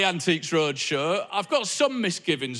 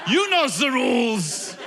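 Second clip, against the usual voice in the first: shouted, high German official voice; German accent; 9 semitones higher, 3 dB louder than usual. Acted. German Roadshow host yelling at a guest in German accent. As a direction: bark the rules in a hammy German accent. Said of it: "Happy with my German accent?".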